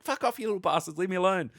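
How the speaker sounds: high-pitched mock outrage; retold angry protest. Player telling pirate crew to back off, retold angry protest.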